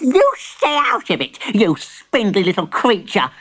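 high, cross granny voice; hammed-up irritable old granny; snapping. A narrator's reading. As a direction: tell off the little creature sharply, high. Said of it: "snapped Granny".

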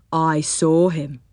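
a gentle, plodding teddy bear. Acted.

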